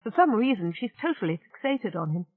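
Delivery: ordinary delivery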